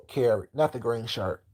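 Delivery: usual speaking voice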